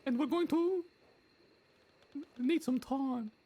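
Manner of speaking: very high joking voice; squeaky put-on voice. To his friends, still in the high robber voice. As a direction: keep the squeak going while chatting.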